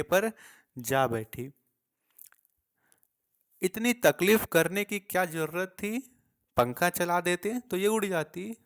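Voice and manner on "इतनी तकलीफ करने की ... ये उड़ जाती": higher, louder amused teasing; laughing tease. A narrator's reading. Mother mocks father's effort with the stick, teasing loudly. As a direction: needle him with amusement. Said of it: "माँ ने हंसकर कहा".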